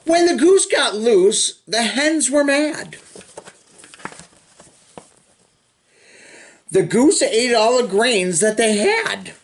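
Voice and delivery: lively sing-song storybook reading; reading to children. Acted. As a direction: read the rhyme to little kids with bounce. Reading picture book to children.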